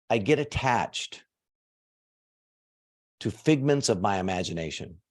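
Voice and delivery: slow dictation. Shown as a demonstration. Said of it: "Will you write down for me".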